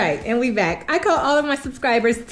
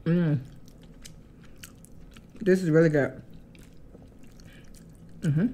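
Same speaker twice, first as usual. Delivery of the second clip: chewing while talking; speaking between bites; mouth full of noodles; 6 semitones lower, 8 dB quieter than usual. Real, not acted. Seen 1: Blue noodles visibly in mouth, chewing while speaking throughout.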